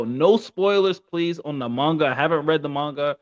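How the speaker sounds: normal talking voice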